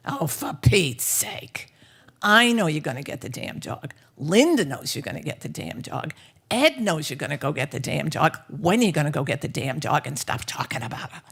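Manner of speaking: loud, emphatic New York exasperation. Acted. Friend's father insisting she fetch the dog, exasperated New York voice. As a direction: hammer each sentence in a gruff New York accent. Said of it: "in his very New York voice".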